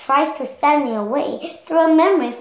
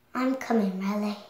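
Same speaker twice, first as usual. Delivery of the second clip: soft determination; 4 semitones lower, 7 dB quieter than usual. Acted. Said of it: "knew she had to return".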